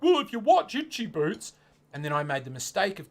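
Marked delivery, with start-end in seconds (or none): 0.0-1.5 mocking commenter. Mocking a know-it-all commenter.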